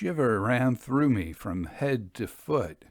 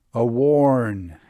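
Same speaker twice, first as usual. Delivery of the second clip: ordinary delivery, nothing marked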